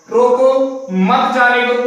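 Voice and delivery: रोको, then a short break, then a firm मत जाने दो; pause after रोको. Shown as a demonstration. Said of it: "आप यहां रुक रहे हो". (रोको के बाद हल्का रुककर मत जाने दो)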